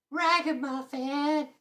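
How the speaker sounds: very high, slow squeak; squeaky silly voice. Pretend. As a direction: stretch it out in a squeak. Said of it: "gonna start calling people ragamuffins".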